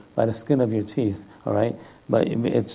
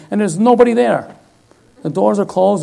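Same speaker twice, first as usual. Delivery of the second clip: incredulous; 8 semitones higher, 6 dB louder than usual. Retold or imitated. Shock at empty workplace.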